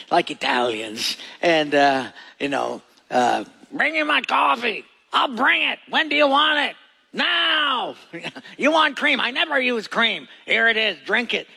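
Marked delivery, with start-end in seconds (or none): 3.7-7.9 hammed-up herder demanding his coffee; Bedouin herder talk. Preacher imitating a herder ordering coffee, high and animated. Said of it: "they always talk like this". 8.6-11.5 lightly voiced, brusque herder serving coffee; a hint of a gruff host pushing the cup; Bedouin herder talk. Preacher imitating a herder serving coffee, a bit higher. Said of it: "they always talk like this".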